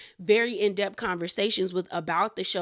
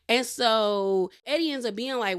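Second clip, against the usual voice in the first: ordinary talk, nothing marked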